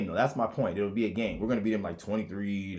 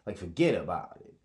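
ordinary delivery

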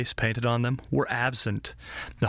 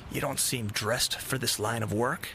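put-on soft old lady; quiet elderly woman; 4 dB quieter than usual. A narrator's reading.

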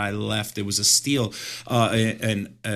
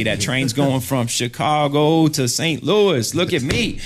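excited boasting; 4 semitones higher, 7 dB louder, slower than usual. Real, not acted. Bragging about his huge wall.

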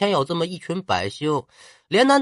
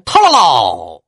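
cannon boom; 4 semitones lower, 8 dB louder, slower than usual. Acted. Cannon blast imitated.

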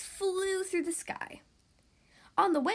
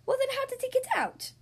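much higher, baffled question; puzzled king; 12 semitones higher than usual. Acted. King asks the detective how Jumbo escaped, high and baffled. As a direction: rise high in disbelief.

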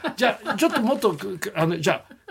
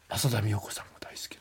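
whisper; 8 semitones lower, 13 dB quieter than usual. Real, not acted. Gossip about actress's crush.

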